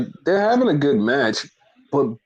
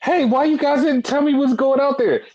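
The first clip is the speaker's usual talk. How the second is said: mock Bayley; 12 semitones higher than usual. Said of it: "Bayley's like".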